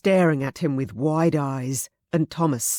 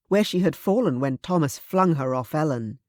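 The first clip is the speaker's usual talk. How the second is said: ordinary delivery, nothing marked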